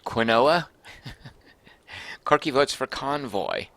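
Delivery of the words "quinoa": "Quinoa" said as kwin-OH-uh, questioning; alternative pronunciation. Shown as a demonstration. Streamer trying a viewer's pronunciation, "Quinoa" as kwin-OH-uh. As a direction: say "Quinoa" in three syllables, kwin-OH-uh, rising like a question. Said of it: "How do you pronounce quinoa?".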